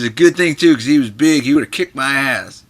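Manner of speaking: a punchy, amused retelling; imitating Johnny. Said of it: "He goes".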